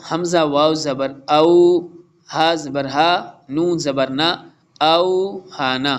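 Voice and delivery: slow careful recitation, तऊ said short, not stretched; short vowel recitation. Shown as a demonstration. Teacher reciting slowly for the students, keeping the vowel short. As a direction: chant each letter combination slowly with a short ending. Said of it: "exercise की तरफ".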